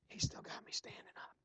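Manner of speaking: breathy whispered aside, no voice. Real, not acted. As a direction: whisper it as if too tired to stand. Exhausted preacher aside to congregation.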